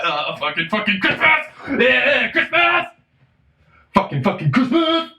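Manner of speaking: high, loud frantic yelling; manic Christmas rant. Retold or imitated. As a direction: crack up and scream about Christmas. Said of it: "I'm just like".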